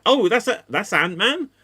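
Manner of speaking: puzzled question, rising at the end; mock disbelief, questioning lift; retold confusion. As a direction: act out baffled double-take. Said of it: "Like, I was so confused".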